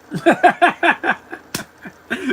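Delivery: loud, high-pitched burst of laughter. Real, not acted. Laughing at his own bit, loud laugh. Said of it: "See, I'm laughing".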